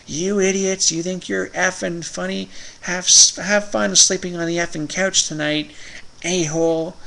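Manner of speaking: imitating angry yell. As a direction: shout it down the stairs like an angry wife. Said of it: "my wife yelling down the stairs".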